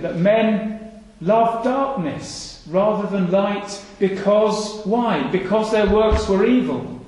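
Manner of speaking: drawn out, slow and sorrowful; slow lament; slow and sad. Real, not acted. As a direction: let each word weigh heavily. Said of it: "it says very sadly".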